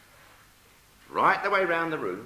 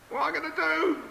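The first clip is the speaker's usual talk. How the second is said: very high, helpless cry; dismayed wail; 13 semitones higher than usual. Acted. Knitter wound up in wool, high dismayed wail. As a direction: pitch it right up in comic distress. Trapped woman voiced in panic.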